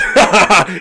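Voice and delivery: booming chieftain laugh; hearty laugh. Acted. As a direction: laugh like a warlord pleased by war. Chieftain laughs at battle news.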